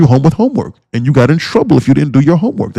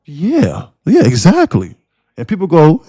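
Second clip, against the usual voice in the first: ordinary talk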